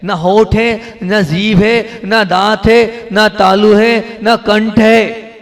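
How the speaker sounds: loud, slow, drawn-out teaching list; loud sing-song listing of speech organs; emphatic slow list. Shown as a demonstration. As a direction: hammer each item home slowly and loudly. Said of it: "माँ का उच्चारण मैंने नाक से किया है".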